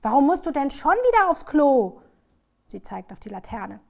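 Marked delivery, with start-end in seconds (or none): 0.0-2.0 high, loud indignant question; stern. A narrator's reading. Child scolds the dog for peeing, stern loud question. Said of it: "guckt Dieter streng an".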